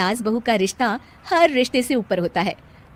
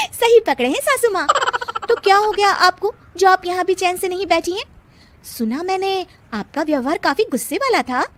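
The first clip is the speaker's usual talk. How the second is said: high, cheeky banter; mock sweet defiance; sassy; 8 semitones higher than usual. Bride bantering with mother-in-law. (ऊँची, नखरेदार चुटीली आवाज़)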